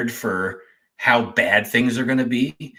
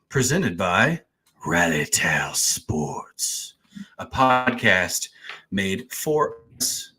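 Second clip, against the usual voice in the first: slow, drawn-out host welcome; show intro; 3 dB quieter, slower than usual. Acted. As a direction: savour every word of the intro.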